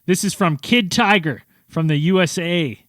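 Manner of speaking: normal talking voice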